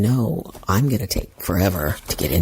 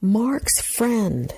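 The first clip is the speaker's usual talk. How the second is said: high, deliberate, spaced-out words; imitating an overly deliberate introduction; loud and slow; 12 semitones higher, much slower than usual. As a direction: say it slowly with a gap, pitched high. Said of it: "I said it real kinda loud and slowly".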